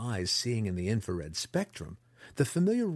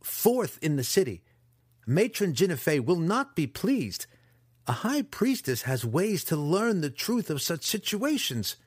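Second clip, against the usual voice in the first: higher, louder proud boast; indignant; 8 semitones higher, 3 dB louder than usual. A narrator's reading. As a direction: threaten with family pride. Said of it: "he said, indignant".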